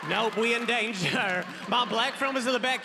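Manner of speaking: ordinary delivery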